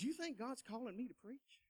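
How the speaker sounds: soft, timid asking; hushed question. Retold or imitated. Young man quietly asks coworker about his calling. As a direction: ask it quietly, almost afraid. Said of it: "I told him the dream".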